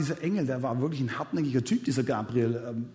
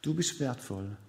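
tender God voice; 5 semitones lower, 9 dB quieter than usual. Pretend. God reassuring the doubting listener, soft and tender. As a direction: gently speak as God who wants you.

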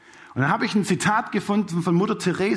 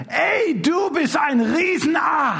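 shouted insult; 10 semitones higher, slower than usual. Retold or imitated. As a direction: hurl it across the park at a stranger. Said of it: "da schreit einer von den Männern".

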